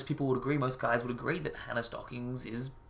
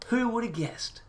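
higher-pitched sarcastic wonder; mock amazement; 8 semitones higher, slower than usual. Commentator mocking a predictable makeover reveal, fake amazement. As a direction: feign surprise at the obvious.

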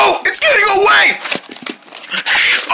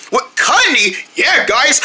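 ordinary talk, nothing marked

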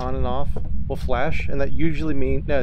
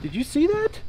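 much higher, amazed question; astonished; 14 semitones higher, 4 dB quieter than usual. Real, not acted. Asking a friend after a spooky moment, amazed. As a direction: call out in disbelief. Startled by ghost event.

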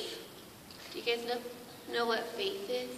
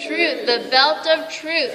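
put-on broad singsong kids theatre; 3 semitones higher, 10 dB louder than usual. Shown as a demonstration. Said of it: "Can you say it with me?".

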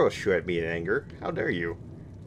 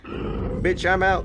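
shouted exit; 11 semitones higher, 5 dB louder, much slower than usual. Real, not acted. Fleeing enemy in game.

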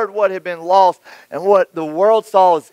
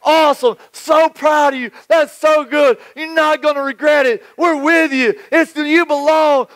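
cheering church folk; 6 semitones higher than usual. Retold or imitated. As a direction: pile on the welcome like a proud congregation. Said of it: "all the church folk were like".

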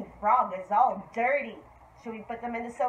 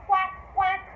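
put-on high duck quacks for small children; two higher, playful quacks; 6 semitones higher than usual. Shown as a demonstration. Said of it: "The duck says".

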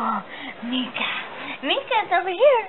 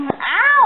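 sharp yelp; 9 semitones higher, 4 dB louder than usual. Real, not acted. Kitten biting, yelps.

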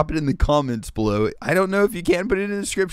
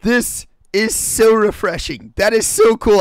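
delighted amazement; 6 semitones higher, 4 dB louder than usual. Real, not acted. Awed by the music.

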